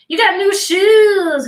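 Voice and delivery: drawn-out delighted exclamation; delighted noticing. Real, not acted. Teacher admiring a student's new shoes, delighted. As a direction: savor the compliment slowly.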